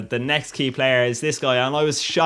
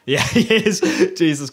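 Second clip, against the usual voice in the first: laughing; 5 semitones higher, 4 dB louder than usual. Real, not acted. Laughing at his own nana joke.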